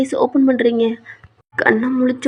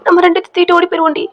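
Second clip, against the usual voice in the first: urgent coaxing; 4 semitones higher, 5 dB louder, faster than usual. Acted. Excited husband urges wife to push.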